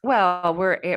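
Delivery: normal talking voice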